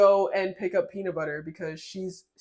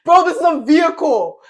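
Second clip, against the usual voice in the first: high loud outcry; loud exclamation; 7 semitones higher, 9 dB louder than usual. Real, not acted. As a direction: exclaim it loudly. Emphatic outburst.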